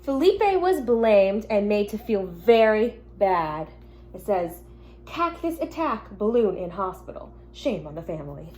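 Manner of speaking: gentle storybook reading to the class; reading to children. Acted. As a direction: read it like a teacher at story time.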